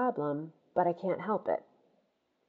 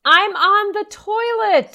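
loud, high, drawn-out shout; slow exasperated yell; 12 semitones higher, 9 dB louder, slower than usual. Acted. Daughter yelling slowly at Mom through the door. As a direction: stretch each word so she gets it.

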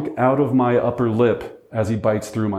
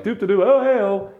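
high, sing-song carefree mock humming; oblivious; 8 semitones higher than usual. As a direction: play the clueless guy strolling up. Himself walking up oblivious.

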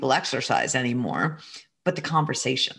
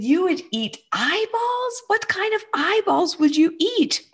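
mock amazement; 14 semitones higher, 3 dB louder than usual. Mother playfully teasing her son.

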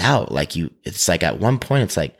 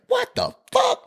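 very high, shrill-free squeak of outrage; spirit's outraged voice. Retold or imitated. The holy spirit reacting to the churchgoer, way up high. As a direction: jump way up in pitch for the spirit's what. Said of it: "the spirit was like".

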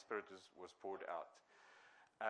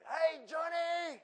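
much higher, much louder call across the flats; street preacher shout; 7 dB louder, much slower than usual. Retold or imitated. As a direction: yell up at a block of flats to a stranger. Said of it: "And he'd go and shout".

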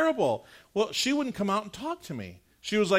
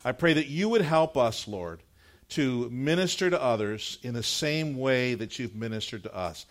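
prayer; 4 semitones lower than usual. Acted. Pastor leads prayer.

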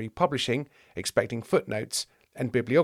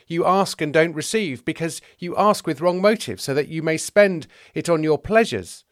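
high, forceful sermon tirade; preaching rebuke; 5 semitones higher, 6 dB louder than usual. Acted. James rebukes the rich man at length, preaching. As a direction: harangue him with scripture, loud and raised.

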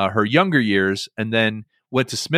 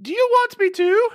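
much higher, odd warbling put-on voice; Julia Child impression. Describing Julia Child's voice, in high put-on warble. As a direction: swoop way up into her strange voice. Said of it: "It's hurting my ears".

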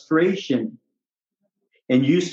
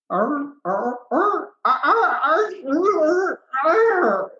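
higher, strained mock groans of pain; 12 semitones higher, much slower than usual. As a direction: imitate a patient moaning in pain, pitched up. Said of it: "this is what it sounds like when they talk about their pain".

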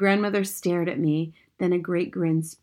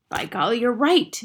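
startled cry; 5 semitones higher, 4 dB louder than usual. A narrator's reading. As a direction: voice rising into realization. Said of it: "she cried".

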